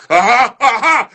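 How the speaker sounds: high, loud hammed-up laugh; loud mock laugh. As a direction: cackle it out loud. Performed laugh in poem.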